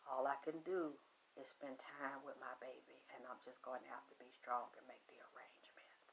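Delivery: bracing yourself in a hushed breath; whispered self-talk. Retold or imitated. Mother steels herself before the morgue, whispering.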